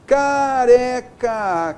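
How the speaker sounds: "Careca" very slow, long ca, re, ca; each letter sounded. Shown as a demonstration. Teacher showing each letter's sound, "Careca" drawn out by syllables. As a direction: stretch every syllable of "Careca". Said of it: "cada letra com seu som".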